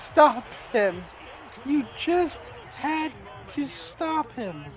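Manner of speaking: high, quiet despairing plea; hushed, hammed-up coach anguish; defeated coach. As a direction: lament it haltingly like a broken coach. Coach voice after going to overtime.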